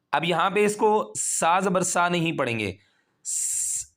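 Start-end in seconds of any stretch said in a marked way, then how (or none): 3.2-3.9 a hissed whistling س. Shown as a demonstration. Teacher showing the whistle sound to avoid. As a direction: hiss a sharp whistle on س. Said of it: "سیٹی کی آواز نہیں آنی چاہیے".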